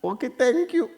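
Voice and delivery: high mock thanks; a wife's high, dismissive thank you; high-pitched sarcastic thanks. Wife sarcastic reply.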